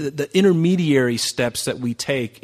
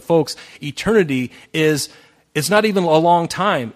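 emphatic preaching; 4 semitones higher, 6 dB louder than usual. Real, not acted.

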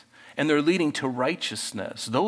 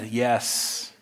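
ordinary talk

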